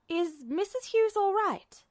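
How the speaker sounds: high, careful inquiry; cautious question. A narrator's reading. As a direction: ask gently, testing the waters. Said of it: "Hawkeye ventured cautiously".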